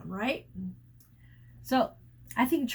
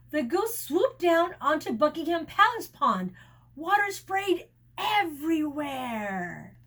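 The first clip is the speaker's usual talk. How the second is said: ordinary delivery, nothing marked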